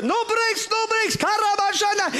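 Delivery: high breezy tongues; high carefree driver voice. Retold or imitated.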